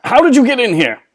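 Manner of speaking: high, loud startled cry; startled shout. Acted. Ballister finds Nimona in his lair, startled shout. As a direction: jump out of your skin at her.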